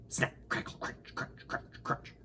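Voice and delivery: whispered crisp crunching sounds; breathy crunch crunch like chewing nuts; peanut crunching noises. A narrator's reading. Reader making peanut crunch noises, whispered. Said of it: "Mister Winky ate some peanuts".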